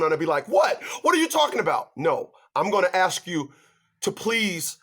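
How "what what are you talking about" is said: higher, mock-shocked What. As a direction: play it baffled and high. His shocked reply retold.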